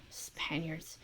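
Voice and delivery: whispered scorn; old teacher whispering contempt; disgusted whisper. Acted. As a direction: spit it under your breath.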